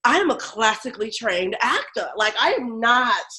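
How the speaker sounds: indignant refusal. Retold or imitated. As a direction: spit out stand-up filth like it's beneath you. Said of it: "I was like".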